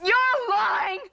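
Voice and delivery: angry accusation. Acted. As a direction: throw the accusation at her mother. Daughter accuses mother of lying.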